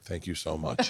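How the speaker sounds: low, soft, relieved thanks; grateful. Retold or imitated. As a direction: drop down softly in retold gratitude. Retold grateful thanks after the panic.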